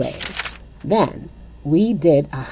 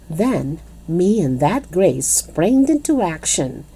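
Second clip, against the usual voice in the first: ordinary delivery, nothing marked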